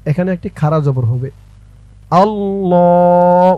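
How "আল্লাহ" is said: Allah drawn out very slowly; slow recitation. Shown as a demonstration. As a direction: stretch Allah in a long chant. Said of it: "অক্ষরে অক্ষরে, শব্দে শব্দে, ভেঙে ভেঙে বলবো". (আল্লাহ শব্দটি খুব টেনে টেনে সুরে)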